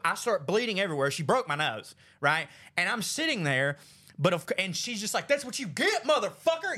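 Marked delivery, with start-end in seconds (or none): 5.3-6.8 higher, punchy retold taunt; mock angry outburst. Sister to the brother she just punched, mock angry outburst. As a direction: crow it like a furious sister, hammed-up.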